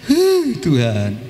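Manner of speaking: slow, heavy sigh into the word; sighing prayer. Pretend. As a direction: let a long tired breath out before it. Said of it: "Tarikan pertama".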